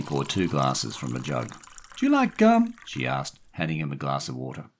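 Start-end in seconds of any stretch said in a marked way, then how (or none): 2.0-2.7 very high, loud woman's question; friendly woman. A narrator's reading. Daphne offering gum to the boy, friendly woman.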